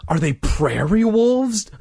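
slow, high, trembling question; scared and shaky. A narrator's reading. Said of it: "in a trembling voice".

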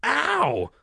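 high yelp of pain; cried-out "ow". A narrator's reading. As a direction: yelp as if the rope burns. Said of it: "I cried out".

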